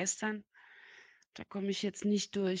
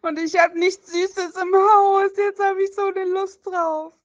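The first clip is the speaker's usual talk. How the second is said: whiny wail; 10 semitones higher, 11 dB louder than usual. Acted. Whining about no sweets.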